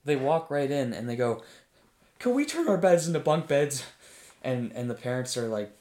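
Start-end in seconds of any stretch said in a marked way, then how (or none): 2.2-3.8 put-on higher, louder, eager kid-like question; hammed-up excited plea; breathless eager asking. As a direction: burst in begging the parents. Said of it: "literally sweating and out of breath".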